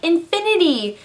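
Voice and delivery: put-on high, proud little kid voice; little girl's answer. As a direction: answer like a proud small girl. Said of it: "And the girl's like".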